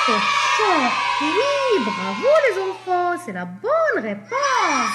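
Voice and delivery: high, loud cheering praise; excited cheer. Real, not acted. Teacher celebrating the children's answer, excited. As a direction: whoop for the right answer.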